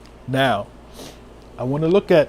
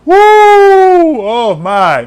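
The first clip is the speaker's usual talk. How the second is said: long, high, loud "woo" falling away; overwhelmed whoop; 12 semitones higher, 8 dB louder, much slower than usual. Real, not acted. Preacher overcome by love of Yah, overwhelmed whoop. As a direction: whoop in praise, then sigh out the words.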